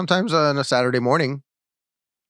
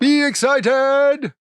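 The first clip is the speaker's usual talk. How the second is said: loud, slow, high cheer; excited; 14 semitones higher, 6 dB louder, much slower than usual. Shown as a demonstration. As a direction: pump up the excitement. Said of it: "shows how to be excited".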